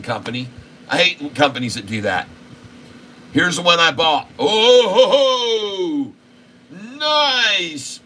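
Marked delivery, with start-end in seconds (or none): 4.4-8.0 high gleeful chuckle; delighted laugh. Real, not acted. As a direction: laugh with glee at the win. Stock went up, laughing.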